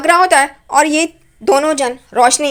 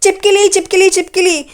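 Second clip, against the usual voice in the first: mock screaming; 5 semitones higher than usual. Lizard lands on Roshni's ear, hammed-up scream. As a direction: shriek it out in playful retold panic. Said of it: "रोशनी चिल्लाना शुरू कर देती है".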